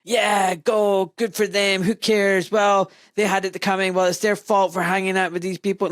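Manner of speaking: sneering imitation of gloating posters; mock cheering on. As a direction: cheer it on like a callous online commenter. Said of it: "the cheering on".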